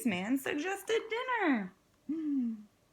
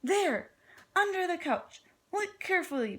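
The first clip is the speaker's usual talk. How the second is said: Pete the pig; 7 semitones higher, 3 dB louder than usual. Acted. Pete speaks as a character.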